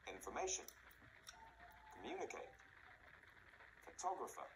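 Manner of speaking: infor-MA-tion, com-MU-nicate, pho-TOG-rapher; mid-word stress. Shown as a demonstration. Teacher giving long-word examples, middle stressed in information, communicate, photographer. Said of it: "almost always in the middle of the word".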